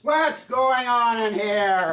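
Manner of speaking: loud boss shout from the doorway; barging-in shout. Acted. Top boss bursting in on managers, shouting. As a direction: storm in and demand answers.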